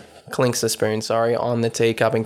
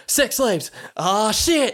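much higher, excited exclamation; mock alarmed outburst; 10 semitones higher, slower than usual. Retelling a friend's reaction to the story, mock alarmed. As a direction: act out a friend's alarm, pitched way up.

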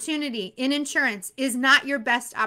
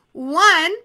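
usual speaking voice

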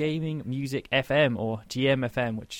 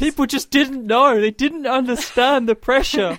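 high, slow, mock-tragic wail; high dramatic voice; 12 semitones higher, 10 dB louder than usual. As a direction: whine it like a wronged martyr. Mock dramatic defence of slavery joke.

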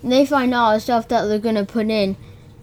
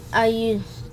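ordinary talk, nothing marked

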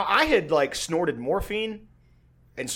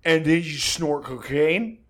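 defensive roommate; 4 semitones higher, 9 dB louder, slower than usual. Retold or imitated. Roommate voiced, defending himself.

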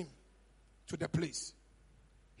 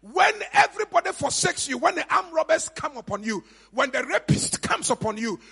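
loud, driving sermon delivery; forceful preaching; 6 semitones higher, 6 dB louder than usual. Acted. Preacher warning the church about staying silent, forceful preaching. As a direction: preach it at full force, voice raised.

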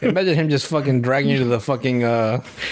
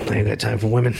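Batman; 4 semitones lower, faster than usual. Acted. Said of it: "I'm Batman".

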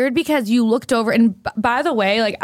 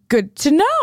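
imitated sketchy reply, pitched up; Michael; 6 semitones higher, slower than usual. Diner answers the waiter about bacon, mock sketchy. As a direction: play it shifty, higher than your usual. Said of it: "Like, wicked sketchy".

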